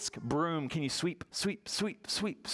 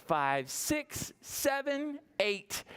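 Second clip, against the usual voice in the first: ordinary talk, nothing marked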